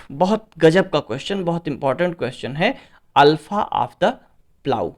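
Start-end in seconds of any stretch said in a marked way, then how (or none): none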